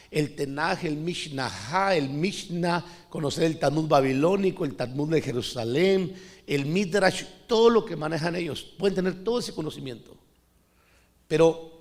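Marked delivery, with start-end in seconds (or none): none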